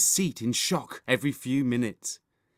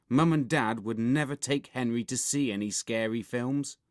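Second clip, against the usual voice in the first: plain reading voice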